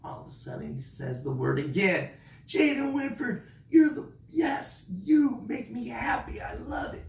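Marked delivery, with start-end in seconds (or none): 2.5-7.0 very high, excited praise; squealing high teacher celebration; celebrating. Retold or imitated. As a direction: cheer him on like he just scored. Celebrating the student's answer.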